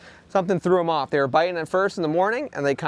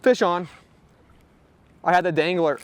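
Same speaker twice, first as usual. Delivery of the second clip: normal talking voice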